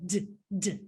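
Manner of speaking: "d" as a short tap, as ends said; short, separate "d" sounds; isolated D sound. Shown as a demonstration. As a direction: give just the "d" sound, not the letter name. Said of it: "the last sound in said".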